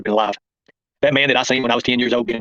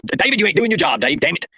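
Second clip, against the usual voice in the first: mock scolding; 8 semitones higher than usual. Mock scolding of helper David.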